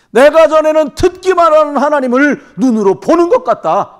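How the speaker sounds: loud, high proclamation of faith; Job's climax, belted out in triumph; Job's climactic cry. Acted. Job sees God at last, proclaiming it loudly and high. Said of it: "막판에 하이라이트를 칩니다".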